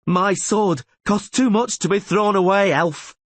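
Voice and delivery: high, loud scornful refusal; defiant cry. A narrator's reading. Rayla crying defiance at the elf rider. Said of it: "she cried".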